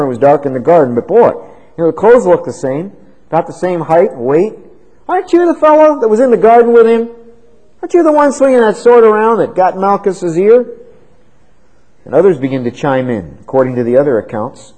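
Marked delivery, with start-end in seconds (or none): 5.1-10.7 higher, louder pointed accusation; accusing relative. Acted. Relative of Malchus confronting Peter, sharp accusing voice. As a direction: corner him with the question. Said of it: "His new accuser is a relative".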